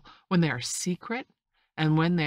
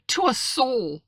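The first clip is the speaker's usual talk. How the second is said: high, louder exclamation of denial; indignant cry; 9 semitones higher, 6 dB louder, slower than usual. A narrator's reading. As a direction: finish the protest in a raised cry. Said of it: "cried the squire".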